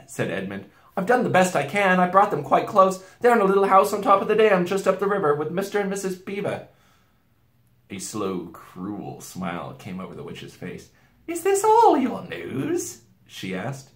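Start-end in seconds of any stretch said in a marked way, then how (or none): 1.0-6.7 put-on higher, louder pleading boy; anxious pleading. A narrator's reading. Boy excusing himself to the witch, anxious and pleading. 11.3-13.0 very high, slow, loud queen; cruel witch. A narrator's reading. Witch questioning the boy, cruel and high-voiced.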